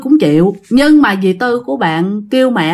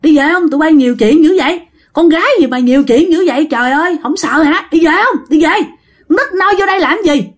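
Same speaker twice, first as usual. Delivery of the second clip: scolding yell; 6 semitones higher, faster than usual. Retold or imitated. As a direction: chase the girls home with an angry mock scold. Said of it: "Ông Sáu mới chửi". (quát mắng con nít, giọng cao, to và nhanh)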